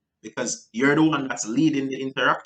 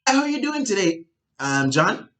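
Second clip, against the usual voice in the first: excited greeting; 8 semitones higher than usual. Shown as a demonstration. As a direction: come in way up high. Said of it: "if you were up here".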